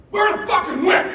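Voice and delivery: high, loud pep shout; fired-up shout. Retold or imitated. Dad firing up his son before the back nine, shouting. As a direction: roar it like a fired-up coach.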